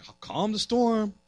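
quiet, fading question; soft reproach. Retold or imitated. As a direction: let it trail off gently. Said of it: "And Jesus is like".

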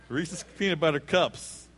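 normal talking voice

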